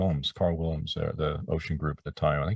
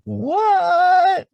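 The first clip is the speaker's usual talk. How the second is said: high, loud stunned yelp; a retold cry of disbelief; shocked what; 8 dB louder than usual. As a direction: jump at the news, shocked. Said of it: "And I go".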